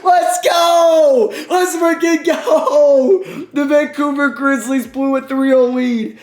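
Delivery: very high, loud victory shouting; euphoric screaming. Real, not acted. Gamer celebrating comeback from three-oh down, euphoric screaming. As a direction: scream with total disbelief and joy.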